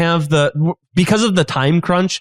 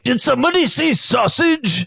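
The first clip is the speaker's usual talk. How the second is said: high, drawn-out showman's call; hammy chef; 9 semitones higher than usual. Acted. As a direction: sing out like an eager cook. Acts the chef character joining in.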